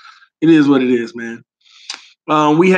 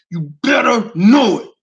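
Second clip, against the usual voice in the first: louder, crying out; 3 semitones higher, 4 dB louder than usual. Real, not acted.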